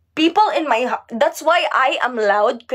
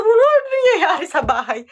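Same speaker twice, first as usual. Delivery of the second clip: high, laughing question; laughing quote; 7 semitones higher than usual. Retold or imitated. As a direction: ask through laughter what's going on. Neighbours asking about noise.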